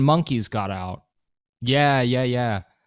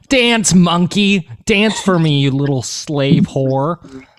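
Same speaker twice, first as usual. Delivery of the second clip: slow, loud, higher-pitched jeering shout; mocking cruel zoo-goer; 5 semitones higher, 7 dB louder, slower than usual. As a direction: ham up a nasty zoo-goer yelling at the cage.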